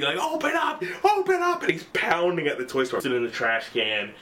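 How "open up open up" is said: very high mock scream; acted screaming. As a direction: imitate a kid screaming to be let in. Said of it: "he starts screaming".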